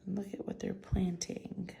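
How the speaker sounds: whispered aside. Real, not acted. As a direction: lean in and whisper it. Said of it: "aside to listeners".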